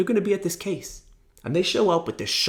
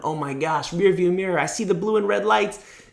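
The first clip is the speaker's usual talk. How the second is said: normal talking voice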